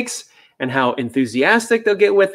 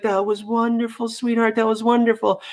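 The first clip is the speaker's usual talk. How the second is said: gentle praise; 9 semitones higher than usual. Retold or imitated. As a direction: coo praise to a nervous dog. Trainer praising a fearful dog.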